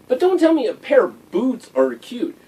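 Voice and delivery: ordinary delivery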